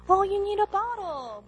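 put-on high, soft mom baby-talk; soft, high-pitched mom imitation. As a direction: coo it lightly to a fussing baby. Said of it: "the mom just comes in".

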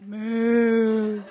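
loud, silly mooing self-mockery; mock cow. Wife answering her husband from the room, mooing like a cow. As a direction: moo it out like a comic cow. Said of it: "I'm in the room, like".

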